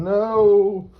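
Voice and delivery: high, loud, long-held cry of alarm; drawn-out yell. Acted. Comedian retelling his escape from the machine, drawn-out yell. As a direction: yell it out, stretched and rising high. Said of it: "so I yelled".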